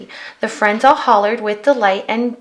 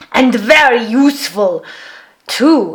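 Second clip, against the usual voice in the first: Viking voice; 4 dB louder than usual. A narrator's reading. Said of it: "exclaimed the Viking".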